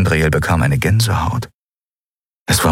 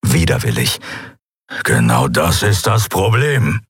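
lightly voiced beast, dark and reluctant; dark growl. A narrator's reading. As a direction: growl it out grudgingly. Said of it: "Sein Knurren war düster".

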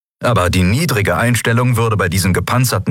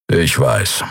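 lower, steady big cat warrior; low creature voice; 7 semitones lower than usual. A narrator's reading. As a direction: Kero calmly accepts praise after the fight, low and dry. Kero, large creature, speaks low.

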